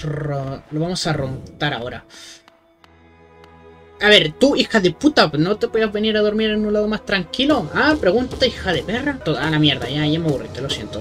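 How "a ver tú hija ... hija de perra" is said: angry yell. Real, not acted. Yelling at sleeping enemy.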